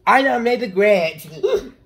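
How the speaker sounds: loud, high Grinch impression. Kid drawing, shouting a Grinch line in his voice. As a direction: belt it out as the Grinch.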